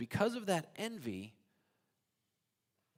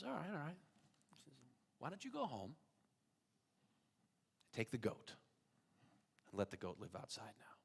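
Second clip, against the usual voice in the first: softer, calm reassurance; calm rabbi; 13 dB quieter than usual. Acted. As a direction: settle him down gently. Rabbi calmly answers.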